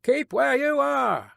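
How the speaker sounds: slow, high order called out; called command. A narrator's reading. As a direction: hold a stranger off with a long high call. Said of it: "the guard called to the voice".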